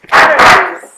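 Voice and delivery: ordinary talk, nothing marked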